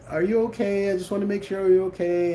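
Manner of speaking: concerned wife. Retold or imitated. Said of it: "you know".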